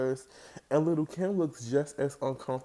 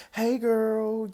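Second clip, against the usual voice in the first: Jackie's greeting; 9 semitones higher, 5 dB louder than usual. Retold or imitated. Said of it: "the way that Jackie greets people".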